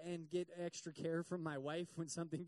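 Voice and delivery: ordinary talk, nothing marked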